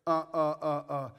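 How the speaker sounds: normal talking voice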